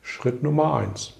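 usual speaking voice

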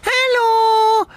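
very high squeaky cartoon girl voice. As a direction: chirp it like a cartoon Heidi. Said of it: "sie reden beide wie Zeichentrickfiguren".

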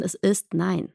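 ordinary talk

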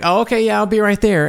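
fake-casual reply to the runner, pitched well up. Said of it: "I was like".

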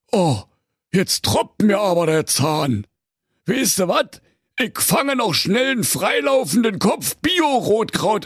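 lightly voiced greedy Berlin ogre; growling dragon guarding treasure. A narrator's reading. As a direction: play a greedy, pushy ogre. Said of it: "der unbarmherzige Karl-Heinz Menschenfresser sprach".

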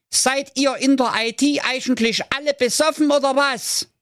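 very high, strident comic outrage; squawking rant. Acted. Jakob berates IT over the phone, high angry squawk. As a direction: rant squeakily in sustained high pitch. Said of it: "krakeelte der Jakob in den Hörer".

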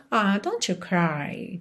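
slow, soft and tender, almost sighing; soft consoling. Shown as a demonstration. Teacher consoling a crying friend, slow and tender. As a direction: comfort a crying friend gently. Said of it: "quase, "It's breaking my heart".

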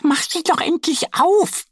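high, eager duck voice; impatient little duck. Acted. Duck Annie can't wait for the bear to open it, high and eager. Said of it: "wackelt vor Ungeduld".

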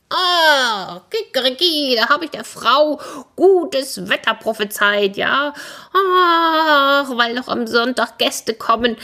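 high crowing rooster voice; high, agitated rooster complaint; lamenting rooster. Acted. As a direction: wail your grievance like a doomed cockerel.

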